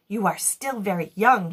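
ordinary delivery, nothing marked

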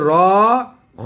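র and খ stretched with rounded vowel; drawn-out letters. Shown as a demonstration. Teacher stretching letters to explain, drawn-out pronunciation. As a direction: hold র and খ long and heavy. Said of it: "এবার টান দিছি".

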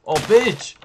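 loud, high exclamation; startled yell. Real, not acted. Player caught off guard in game, startled yell. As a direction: cry out in sudden surprise.

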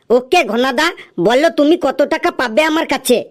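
ordinary delivery

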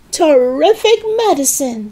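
high-pitched exclamation of glee; delighted cry. A narrator's reading. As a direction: cry out, thrilled with it. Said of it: "she cried".

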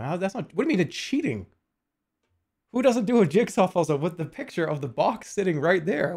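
indignant protest. Real, not acted. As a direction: argue back at an absurd accusation. Protests chat's accusation of cheating.

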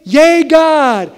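high, loud cheer; mock joyful cheer. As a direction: cheer God on, thrilled. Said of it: "One day".

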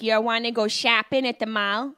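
very flat A's, Syracuse style; Syracuse accent. Acted. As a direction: Syracuse accent with abrupt stops and flat vowels. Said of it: "It's very flat A's".

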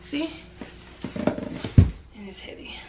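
whisper. Real, not acted. Whispered aside about box.

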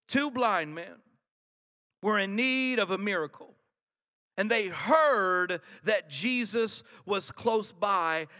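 ordinary talk, nothing marked

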